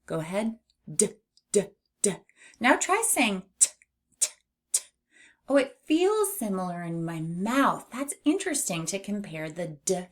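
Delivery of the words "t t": T as a bare puff of air; unvoiced T sound. Shown as a demonstration. Teacher contrasts it with D, voiceless T. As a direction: say T alone with no voice, twice. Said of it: "the T sound is not voiced".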